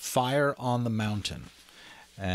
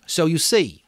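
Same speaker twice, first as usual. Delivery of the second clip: put-on higher, louder boy leader; Ralph; 8 semitones higher, 8 dB louder than usual. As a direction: lay it out to the boys. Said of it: "said Ralph".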